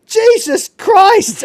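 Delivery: high, loud, drawn-out retold cry; mock shout. Narrator acts out the preacher shot and falling, drawn-out mock shout. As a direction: yell it high and long, hamming it up. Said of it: "turns around ... and shouts".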